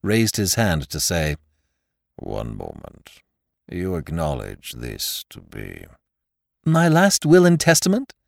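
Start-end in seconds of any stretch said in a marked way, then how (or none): 2.2-5.8 low, slow, faded muffled voice. Acted. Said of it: "in a muffled voice of which there seemed to be very little left". 6.7-8.1 put-on amused young man voice. Nephew jokes, laughing.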